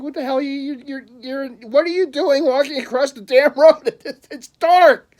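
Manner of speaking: high, loud, incredulous outburst; exasperated yelling. Retold or imitated. Driver yelling at drunks crossing the road, exasperated. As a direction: stammer into an exasperated yell, stretching the last words.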